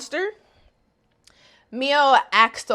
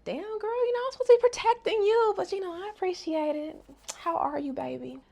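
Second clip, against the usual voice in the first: high mock voice; 10 semitones higher, 6 dB quieter than usual. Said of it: "Kudo's like".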